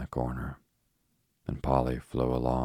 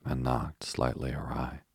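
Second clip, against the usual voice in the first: usual speaking voice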